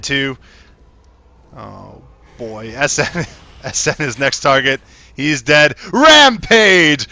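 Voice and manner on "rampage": booming hyped announcer call; fighting game announcer. Retold or imitated. Game kill streak announced.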